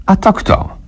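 a sudden high yelp of alarm; startled by the knock, asks high. A narrator's reading. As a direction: nagle wysoki, zaniepokojony głos. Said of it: "zaniepokoił się Bognar".

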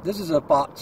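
ordinary delivery

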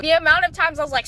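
ordinary delivery

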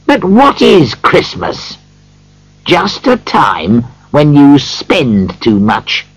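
high, indignant tirade, building to the end; indignant old miser working himself up; cross rant. A narrator's reading.

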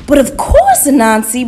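high, very loud, obliging reply; hearty bull. Acted. Bro Bull agreeing to help the spider, hearty and loud. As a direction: boom out eager agreement.

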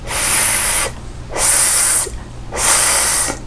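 "ス" as a vowelless hiss; voiceless, held "ス"; S breath sound. Shown as a demonstration. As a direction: push air out on "ス". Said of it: "息を押し出す感じで".

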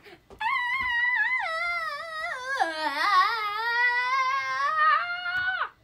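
high, loud, sustained whoop of laughter; loud howling laugh. Real, not acted. Reader delighted by the favorite page, loud howling laugh. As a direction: let out a long loud laughing howl.

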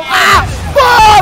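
loud, high battle yell; booming game cry; explosive shout. Real, not acted. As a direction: shout it like a blast going off. Fires weapon in game.

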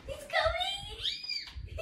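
high, drawn-out panicked yell; panicked shout. Real, not acted. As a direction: stretch it out in alarm.

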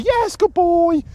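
very high, loud, drawn-out squeal; excited praise. Real, not acted. Trainer rewards the dog after a bit of pressure, excited praise. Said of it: "the praise and the vocal inflection".

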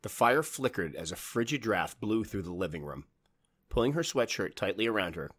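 usual speaking voice

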